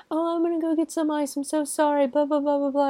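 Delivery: high-pitched, hurried impression of an apologising husband; mock frantic apology. Voices the husband's frantic fake apology.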